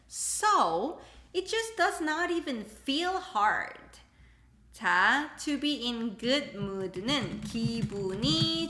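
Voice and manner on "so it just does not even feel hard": slow and higher, leaning on not even; emphatic. Shown as a demonstration. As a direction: stretch out does not even feel hard. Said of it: "You're emphasizing".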